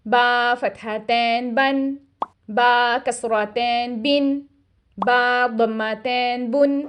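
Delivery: बन, बिन, बुन said slowly, each ending in a clear nasal n; slow Quranic recitation of tanween; tanween reading. Shown as a demonstration. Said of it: "हम इसे कैसे पढ़ेंगे?".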